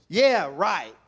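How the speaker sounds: YEAH, RIGHT loud and emphatic, falling; varied inflection. Shown as a demonstration. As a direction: say "Yeah, right" loudly and firmly. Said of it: "I said 'em four different times".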